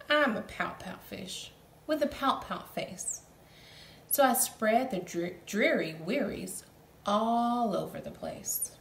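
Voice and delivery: gloomy pout fish chant; sing-song the sad refrain; glum fish refrain. Acted.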